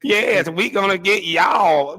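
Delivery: slow, drawn-out fake Southern drawl; mock a put-on Southern twang; country Southern accent. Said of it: "trying to have a country Southern accent".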